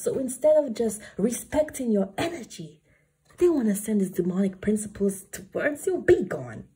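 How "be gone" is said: commanding banish. Acted. Banishing demons in reading.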